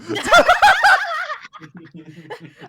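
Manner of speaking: loud, high squealing laugh; shrieking laugh. Real, not acted. VTuber cracking up before confessing her gacha love, shrieking laugh.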